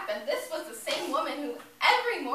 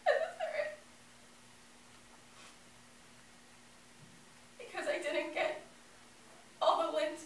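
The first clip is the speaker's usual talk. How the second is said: grief-stricken; 8 semitones higher than usual. Acted. Grieving child lists self-blame.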